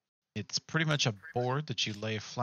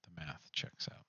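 whisper; 5 semitones lower, 10 dB quieter than usual. Real, not acted. Whispered aside.

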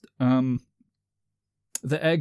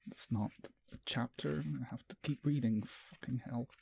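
much softer grumbling under the breath; muttered aside; 15 dB quieter than usual. Real, not acted. Reader grumbling to himself that the chapter isn't over. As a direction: mumble to yourself, not for listeners.

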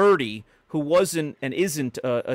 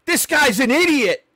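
higher, louder teasing jab; mock insult; 11 semitones higher, 9 dB louder than usual. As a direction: rib him like an old friend, loud and high. Celebrity mocking him to friend.

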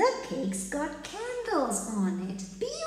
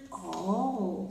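usual speaking voice

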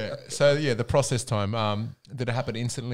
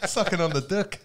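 put-on Kiwi accent; 11 semitones higher, 4 dB louder than usual. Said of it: "That's a horrible Kiwi accent".

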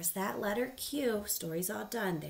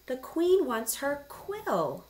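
high, loud, slow announcement; storybook servant with the answer; eager servant; 7 semitones higher, 6 dB louder, much slower than usual. A narrator's reading. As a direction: proudly announce it, drawing out the words.

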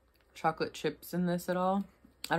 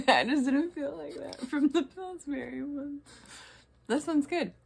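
laughing; 10 semitones higher, 5 dB louder than usual. Real, not acted. Laughing while tasting cookie dough.

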